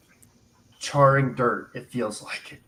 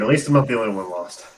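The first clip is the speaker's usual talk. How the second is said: normal talking voice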